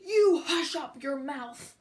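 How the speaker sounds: loud angry king's outburst; loud, imperious scolding; howling. A narrator's reading. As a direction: howl it down at an underling. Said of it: "howled the mighty King Yertle".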